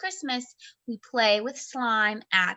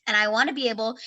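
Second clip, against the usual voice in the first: ordinary talk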